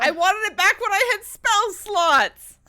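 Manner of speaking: loud, slow, drawn-out lament; mock anguished wail. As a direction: wail it like a tragic death. Character dying, mock wail.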